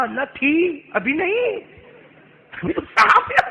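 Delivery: alarmed protest. Acted. Moses panics at the snake.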